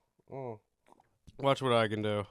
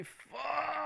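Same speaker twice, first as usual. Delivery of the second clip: high yelp; 9 dB quieter than usual. Real, not acted. High cry.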